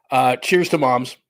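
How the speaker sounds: ordinary talk, nothing marked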